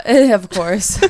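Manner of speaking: bursting out laughing. Real, not acted. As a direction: laugh through the words. Laughing at friend's joke.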